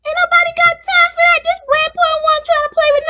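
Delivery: high loud meme shout. Retold or imitated. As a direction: belt out the meme line. Quoting the famous meme line.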